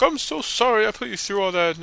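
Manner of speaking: high, gushy put-on apology; mock gushing apology. Girl hugging someone after trouble, mock gushing apology.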